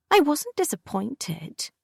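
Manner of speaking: high, slow sullen mutter; a girl sulkily muttering. A narrator's reading. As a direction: grumble it down at the table. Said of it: "she muttered".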